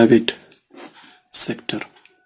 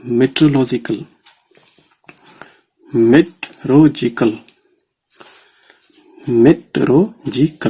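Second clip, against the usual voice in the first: Meteorological said slowly and clearly; teacher dictating a word to a shorthand class; slow dictation; slower than usual. Shown as a demonstration. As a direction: spell out meteorological by ear. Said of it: "तो meteorological".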